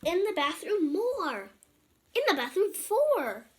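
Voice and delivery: high, loud game-show count; loud announcing. Acted. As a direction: announce it like a reveal.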